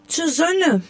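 put-on high, childlike snowflake voice; small high-pitched character reply; eager little snowflake. A narrator's reading. Snowflake answers the wind eagerly.

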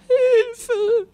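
very high, loud scream; screaming for help. A narrator's reading. Rosa seized by the monster, screaming for help. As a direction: shriek in alarm. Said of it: "schrie Rosa".

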